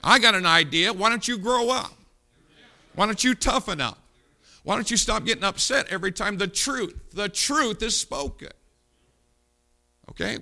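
loud, high fiery preaching; preaching at full force. Real, not acted. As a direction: thunder it from the pulpit.

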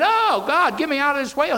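high, loud desperate plea; Jonah crying out. Retold or imitated. Preacher voicing Jonah in the whale, high desperate cry. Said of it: "and said".

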